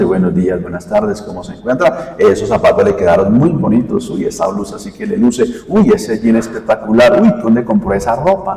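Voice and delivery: low, soft, gossipy small talk; hushed side chatter, lightly mimicked; chatting churchgoers. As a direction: murmur quick compliments under your breath in the queue. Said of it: "muchos se distraen, ¿no? Saludándose".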